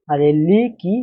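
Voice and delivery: usual speaking voice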